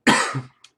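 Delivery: short sick cough; a quick dry cough. Real, not acted. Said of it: "Pardon the coughing".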